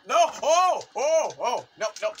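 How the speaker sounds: high, loud bursts of alarm; frantic yelling. Real, not acted. Player fighting in the wrestling ring, frantic yells. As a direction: yell out with each blow.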